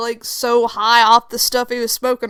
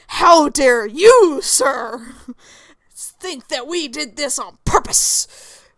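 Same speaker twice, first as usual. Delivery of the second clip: slow, emphatic mock outrage; very emotional indignation; 2 semitones higher, 5 dB louder, slower than usual. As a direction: open loud and drawn out, affronted. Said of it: "still very emotional".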